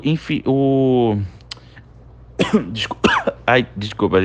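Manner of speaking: coughing after choking; breaking off with a choking cough. Real, not acted. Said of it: "engasguei aqui".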